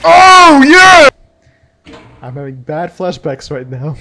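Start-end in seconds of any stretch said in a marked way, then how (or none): 0.0-1.1 high excited whoop; loud cheer. Real, not acted. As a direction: celebrate at full voice. Cheering loudly.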